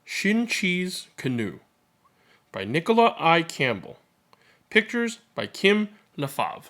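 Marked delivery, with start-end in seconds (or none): none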